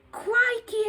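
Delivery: high, loud startled cry; boy suddenly spots something; sudden exclamation. Acted. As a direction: blurt it out in surprise. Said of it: "exclaimed Jonathan suddenly".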